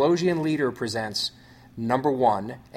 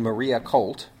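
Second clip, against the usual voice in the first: usual speaking voice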